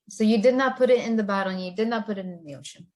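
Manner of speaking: fast, run-on summary; quick recap of the plan; rapid recap. Acted. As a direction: rattle it off in one breath.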